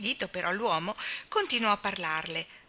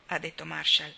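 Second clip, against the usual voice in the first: usual speaking voice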